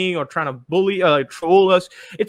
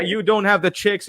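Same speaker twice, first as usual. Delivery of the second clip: ordinary talk, nothing marked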